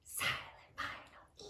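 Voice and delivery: silent final E whispered; whispered spelling cue. Shown as a demonstration. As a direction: whisper it, since the letter is silent. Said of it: "marking the silent letter".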